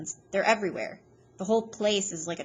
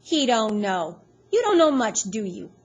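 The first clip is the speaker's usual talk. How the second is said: loud mocking sneer; mock the kid with contempt; scornful, louder jeer; 3 semitones higher, 9 dB louder than usual. A narrator's reading. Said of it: "Iggy snorts and he says".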